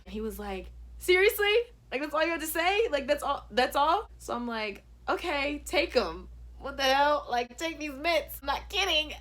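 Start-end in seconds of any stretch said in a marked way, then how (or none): 1.0-4.1 high, loud incredulous questions; mock disbelief. Imitates his amused disbelief. 5.1-9.1 loud, higher-pitched pushy retort; exasperated insistence. Retold or imitated. Acts insisting he take the mints.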